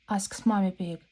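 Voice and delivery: plain reading voice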